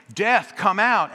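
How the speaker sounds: loud, high, slow call; booming call. Retold or imitated. Pastor pounding on the doors, loud slow booming call. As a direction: hammer the words like pounding a door. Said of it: "Bam, bam, bam".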